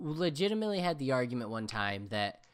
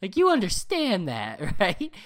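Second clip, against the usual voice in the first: exasperated rant; 3 semitones higher, 7 dB louder than usual. Retold or imitated. Arguing with friend Matt about games.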